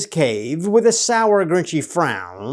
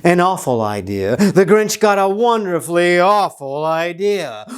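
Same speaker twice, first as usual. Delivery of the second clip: plain reading voice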